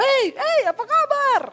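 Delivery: high, loud Sulawesi hail; rough loud greeting. Retold or imitated. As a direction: call out brashly, no softness at all. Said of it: "Nggak ada lembut-lembutnya". (sapaan kasar keras bernada tinggi)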